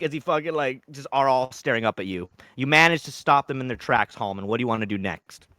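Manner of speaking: usual speaking voice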